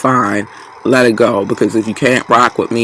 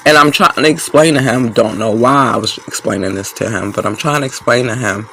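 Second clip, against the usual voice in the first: ordinary talk, nothing marked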